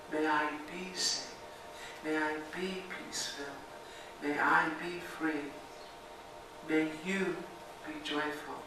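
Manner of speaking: gentle loving-kindness recitation; guided meditation. Acted. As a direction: offer each line like a quiet blessing. Recites his own metta version.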